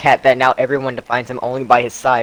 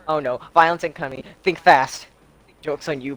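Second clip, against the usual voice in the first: usual speaking voice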